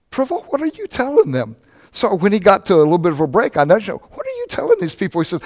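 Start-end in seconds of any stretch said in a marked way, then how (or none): 0.1-1.6 high puzzled question; a surprised, high what are you telling them. Retold or imitated. Puzzled at laughing ladies. 3.9-5.2 very high incredulous question; nudging the pastor, a high baffled whisper-question. Retold or imitated.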